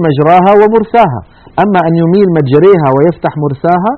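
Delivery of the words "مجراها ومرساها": مجراها and ومرساها with open a, higher; both words open. Shown as a demonstration. Teacher voicing the other hypothetical reading, both open. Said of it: "أو يقول".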